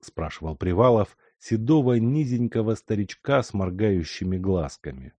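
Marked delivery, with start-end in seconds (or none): none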